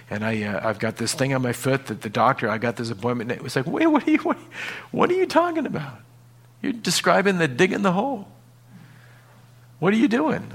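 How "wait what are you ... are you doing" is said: much higher, bewildered voice; high-pitched baffled onlooker; incredulous questioner. Pretend. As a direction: squeak out the disbelief.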